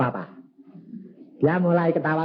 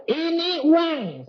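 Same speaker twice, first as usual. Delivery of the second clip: high, slow, drawn-out reveal; savoring the triumph; triumphant announcement; 12 semitones higher, much slower than usual. Retold or imitated. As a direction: stretch it out like a proud reveal. Handing money to wife triumphantly.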